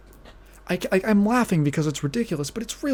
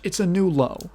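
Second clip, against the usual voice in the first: ordinary delivery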